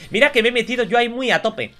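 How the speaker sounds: usual speaking voice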